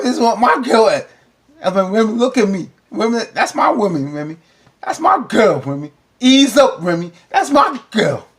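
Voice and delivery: put-on high, loud, excited boast about his girl; proud loud bragging. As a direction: shout it out like a proud boyfriend. Acting Remy's proud claim.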